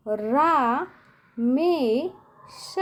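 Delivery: रा, मे, श with a pause after each; syllable-by-syllable dictation. Shown as a demonstration. Teacher dictates to children, रा मे श in separate syllables. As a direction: sound out रा मे श one at a time. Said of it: "क्या लिखना है?".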